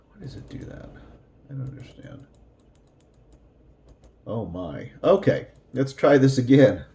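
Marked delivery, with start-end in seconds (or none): 0.1-2.3 whispering to herself, puzzled; whispered aside. Real, not acted. Teacher to herself about the skipping video: puzzled whisper. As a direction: breathe it under your breath. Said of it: "muttering at a glitchy video".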